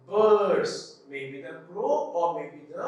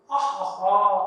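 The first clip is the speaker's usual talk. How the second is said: a bright ha ha ha laugh to show laughing; demo laugh; 8 dB louder than usual. Shown as a demonstration. Teacher showing the class a laugh. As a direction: laugh out loud as the example of laughing. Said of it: "I am laughing".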